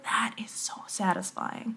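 whisper. Real, not acted. Heartfelt confession about sharing.

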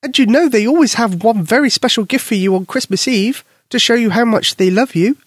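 mother voice. Acted. Mother coaxes daughter.

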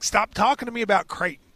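normal talking voice

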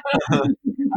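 bright giggle; high laugh. Real, not acted. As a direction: laugh at the joke. Laughs at joke.

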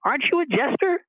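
retold calling out. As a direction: imitate putting a question across the table. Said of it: "he calls out to the guy".

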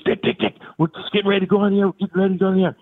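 high, fast urgent hushing of a guest; mock flustered partner; imitating Bob hushing. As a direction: shoo him off before the broadcast starts. Bob calming Vitale.

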